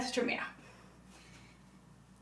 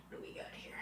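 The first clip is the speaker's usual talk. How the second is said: whispering; 22 dB quieter, much faster than usual. Real, not acted. Softly checking the rising dough.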